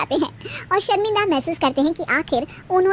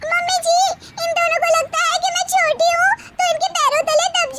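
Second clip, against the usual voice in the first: put-on much higher, indignant girlish voice; youngest daughter-in-law; 11 semitones higher than usual. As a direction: insist on your rights, pitched way up. Youngest bahu, high put-on voice.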